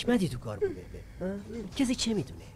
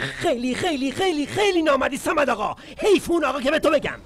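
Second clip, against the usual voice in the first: rising, louder cries of betrayal; hurt angry shout; 7 semitones higher, 4 dB louder than usual. Acted. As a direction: yell it higher and higher, wounded. Hurt outburst at Samad. (فریاد دلخور و عصبانی)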